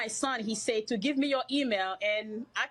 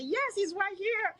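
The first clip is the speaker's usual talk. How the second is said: excited reassurance; 11 semitones higher, slower than usual. Retold or imitated. Mother tells son on phone.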